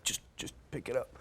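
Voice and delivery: hushed whisper. Retold or imitated. Coworker whispering to pick up the phone. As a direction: urge it in a whisper.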